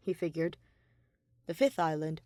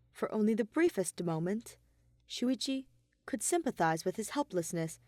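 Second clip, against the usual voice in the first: usual speaking voice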